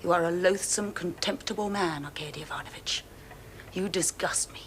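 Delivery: soft, icy scorn; quiet contempt. Acted. Cold contempt toward man.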